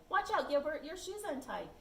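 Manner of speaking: high, loud mock alarm; fake warning. Margaret fooling Gilbert, high mock alarm. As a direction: trick him with a sudden warning. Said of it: "Margaret said".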